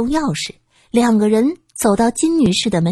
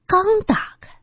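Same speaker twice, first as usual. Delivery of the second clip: usual speaking voice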